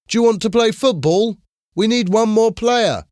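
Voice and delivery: high, eager called-out invitation; shouting. A narrator's reading. Jim invites Tony to join the football game, shouting. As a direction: call it out high to someone across the pitch. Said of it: "shouted Jim".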